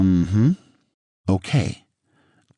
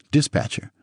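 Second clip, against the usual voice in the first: plain reading voice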